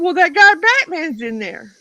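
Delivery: imitating an agitated yell, high and loud; mock outraged holler; screaming caller. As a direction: yell it as the panicked complainer. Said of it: "why are you screaming".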